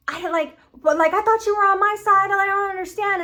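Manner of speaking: high-pitched acted protest; hurt protest. Retelling Charlie feeling Vaggie left her side, hurt protest. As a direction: plead it high and put out. Offended character's protest, retold.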